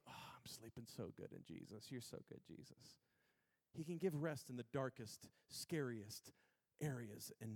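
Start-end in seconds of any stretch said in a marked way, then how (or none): 0.1-3.0 low, drowsy murmur fading away; Peter, sleeping contentedly. Acted. Peter asleep before execution, murmuring sleepily to Jesus. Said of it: "and he goes".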